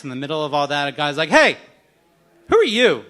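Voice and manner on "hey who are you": challenging call-out. Retold or imitated. Stranger challenges him at cake.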